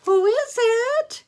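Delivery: high, loud, drawn-out granny voice; slow, very high sing-out call. Acted. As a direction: call to the door as a storybook granny. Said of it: "called out Granny".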